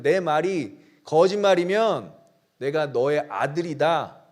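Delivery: usual speaking voice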